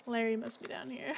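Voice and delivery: ordinary delivery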